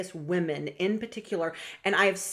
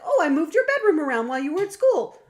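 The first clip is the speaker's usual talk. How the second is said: much higher, louder, fast breezy chirp; tossed off brightly as if no big deal; cheery mom announcing she rearranged the room; chirpy Gemini parent; 8 semitones higher, 8 dB louder, faster than usual. Retold or imitated. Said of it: "Gemini's like".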